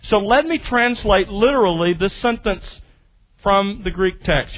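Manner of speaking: ordinary talk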